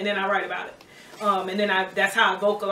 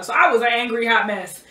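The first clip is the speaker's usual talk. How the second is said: normal talking voice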